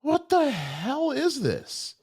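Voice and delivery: high, drawn-out exasperation; slow, wailing disbelief; frustrated. Real, not acted. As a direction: let the pitch soar in bewildered frustration. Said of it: "I'm, I'm frustrated here".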